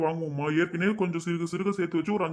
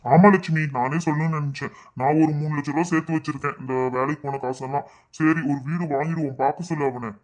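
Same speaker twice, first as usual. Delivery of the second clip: low father voice, steady and agreeing; father of the family; 3 semitones lower than usual. Acted. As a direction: play the father consenting to his wife's plan. (தாழ்ந்த குரலில் அப்பா பாத்திரம்)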